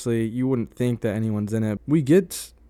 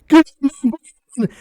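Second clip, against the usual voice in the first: sudden shout, much higher and louder; yell; 10 dB louder, slower than usual. Retold or imitated. Neighbor friend ambushing the group in the barn, yelling. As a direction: scream it like an ambusher in the dark. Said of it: "and he goes".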